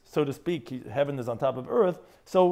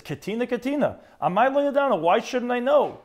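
calling out higher and louder; raised voice; 8 semitones higher, 4 dB louder than usual. Retold or imitated. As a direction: lift your voice into a high, indignant call. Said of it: "he lifted up his voice".

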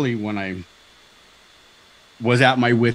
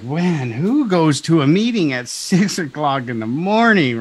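higher, louder, slow disbelieving complaint; incredulous grumble; 6 semitones higher, 5 dB louder, slower than usual. Retold or imitated. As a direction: drag it out in disbelief. Retold complaint about early meeting.

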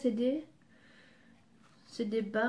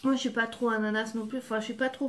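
talking while chewing food; muffled by biscuit in mouth; mouth full, chewing; faster than usual. Real, not acted. Seen 2: Ate biscuit just before; mouth seems to be chewing while she wipes hands.

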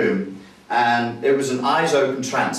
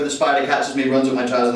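ordinary talk

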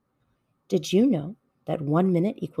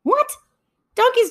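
a sudden high what; startled what; 4 dB louder than usual. Acted. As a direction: squeak out the disbelief. Hare disbelieving.

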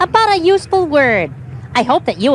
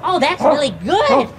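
normal talking voice

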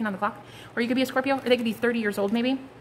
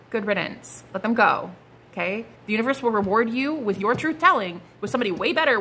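normal talking voice

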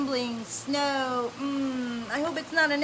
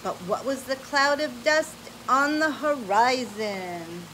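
bright, animated read-aloud voice; lively storybook reading; 2 semitones higher than usual. Acted. Parent reading an adventure story to a child, animated.

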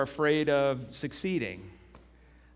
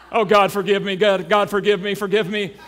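panicked prayer; 2 semitones higher, 6 dB louder than usual. Retold or imitated.

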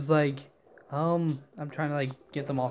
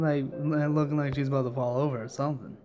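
ordinary talk, nothing marked

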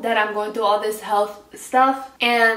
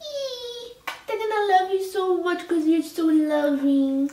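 high, tearful squeal into tender words; real tears of joy, voice pitched way up; happy crying; 8 semitones higher than usual. Real, not acted. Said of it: "You made me cry".

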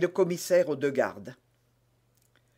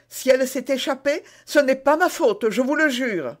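higher-pitched pleading suspect; Bonacieux qui proteste; 9 semitones higher, 6 dB louder than usual. Acted. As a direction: protest your innocence earnestly. Voices Bonacieux protesting innocence. (suspect qui se défend, voix plus aiguë)

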